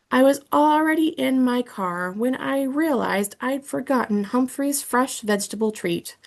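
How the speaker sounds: ordinary delivery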